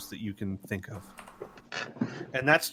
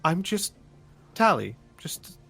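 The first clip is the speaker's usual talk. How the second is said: put-on higher-pitched, halting young-hero plea; earnest, secretive Tally; 5 semitones higher than usual. Tally begs friends to tell no one, in an earnest higher voice.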